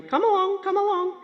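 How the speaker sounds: imitating Gran coaxing cows, high and lilting; calling the cows. Gran calling cows with the feed bucket, high sing-song call.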